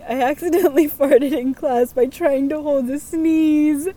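reading through giggles; laughing. Real, not acted. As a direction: laugh your way through the line. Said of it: "read this without laughing".

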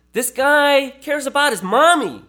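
high-pitched mock rooting for him; won-over viewer. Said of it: "you're just like".

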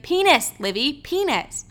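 high-pitched, grudging outburst; exasperated mom. Retold or imitated. Mom finally saying the word for Livy, exasperated. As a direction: give in with mock parental exasperation. Voices exasperated mother giving in.

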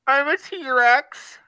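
high, slow squeaky dinosaur roar; tiny squeaky trying to roar. Pretend. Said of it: "take a guess at what it sounds like".

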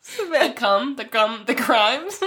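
giggling over a word slip; laughing through a slip. Real, not acted. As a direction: laugh while correcting the word. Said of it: "when you mess up on words".

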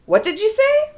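put-on playful loud child call; mock loud child. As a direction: shout it like a curious kid. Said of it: "my son said quite loudly".

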